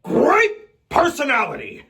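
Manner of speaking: blustering overblown delivery; older Al Pacino. Retold or imitated. Aging star ranting, loud and drawn. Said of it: "he started to talk more like this".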